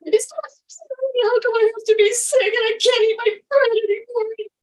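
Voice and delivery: high, loud, tearful wail; retold tantrum scream. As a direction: relive the kitchen meltdown, half crying. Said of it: "I'm screaming and I'm yelling".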